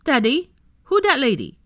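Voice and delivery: put-on high, loud little toddler asking; a toddler pointing and asking daddy. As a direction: lisp it like a small child tugging at daddy. Toddler asks his father.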